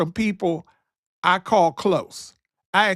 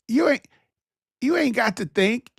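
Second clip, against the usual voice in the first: ordinary talk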